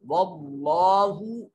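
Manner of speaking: वल्लाहु with a thick, full lam, slow; heavy lam. Shown as a demonstration. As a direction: recite वल्लाहु slowly with the lam made heavy. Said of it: "लाम को लंबा भी करेंगे". (वल्लाहु में लाम मोटा और धीमा)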